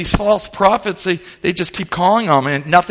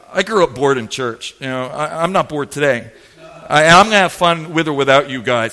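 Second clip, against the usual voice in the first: usual speaking voice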